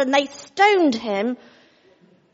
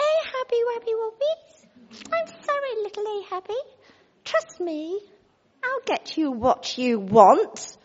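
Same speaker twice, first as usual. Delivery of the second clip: put-on cooing baby talk; 8 semitones higher than usual. Jezebel sweet-talking her sulking husband. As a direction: coo to him like a pouting toddler.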